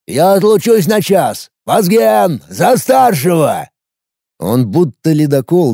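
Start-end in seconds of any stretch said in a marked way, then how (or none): 0.1-3.7 gruff boss. A narrator's reading. Glyba keeps barking orders.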